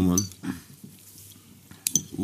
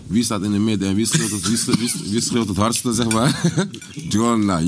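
ordinary talk

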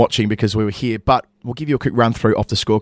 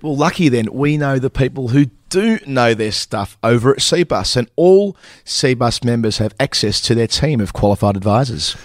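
scripted ad read. Acted. Hosts act out sponsor ad read.

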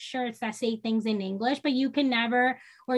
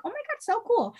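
put-on much higher, awed child voice; imitating an amazed kid; 12 semitones higher, faster than usual. Mom imitating kids amazed her kids know two languages, high awed child voice. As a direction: jump way up like a wowed kid. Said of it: "And they're like".

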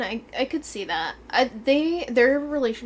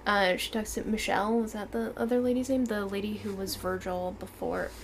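usual speaking voice

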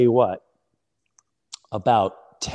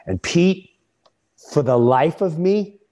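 normal talking voice

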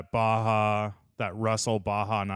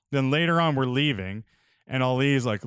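usual speaking voice

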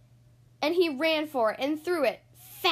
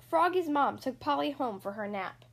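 plain reading voice

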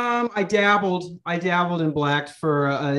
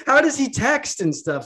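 laughing question; 10 semitones higher, 4 dB louder than usual. Real, not acted. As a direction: ask it high and loud through a laugh. Laughs mid question about friend.

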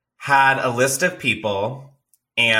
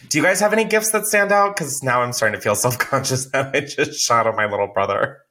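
usual speaking voice

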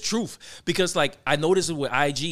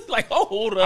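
laughing; 6 semitones higher than usual. Real, not acted. Laughing reaction.

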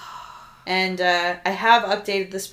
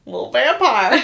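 put-on louder Southern-accented echo; Miss Allie Lane; 3 semitones higher, 5 dB louder than usual. Co-host echoing friend's phrase, Southern accent. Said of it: "Miss Allie Lane".

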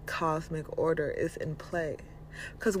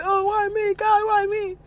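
much higher, louder whining cry to God; mock self-pitying wail; 13 semitones higher, 6 dB louder than usual. Mocking someone in trouble who complains instead of trusting, with a loud high wail. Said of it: "Instead of saying".

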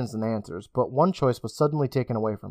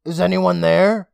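higher, louder, slow call; 5 semitones higher, 5 dB louder than usual. Acted. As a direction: call into the dark. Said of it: "Emma called out".

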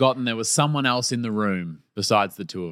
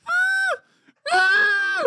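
high mock shriek of alarm; two thin, high cries of horror; imitated shocked cry. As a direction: squeal as if caught out. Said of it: "and just went".

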